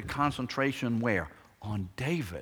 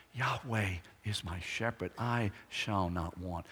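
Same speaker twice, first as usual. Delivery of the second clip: quiet confident quote; 3 semitones lower, 7 dB quieter than usual. Retold or imitated. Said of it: "he says".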